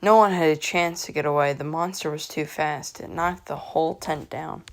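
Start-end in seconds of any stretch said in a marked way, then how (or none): none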